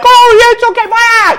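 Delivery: very high hammed-up wail; screaming auntie. Auntie joining the mother's grief, mock screaming. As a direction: imitate a hysterical shrieking aunt. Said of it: "They're all screaming".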